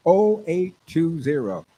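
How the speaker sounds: ordinary delivery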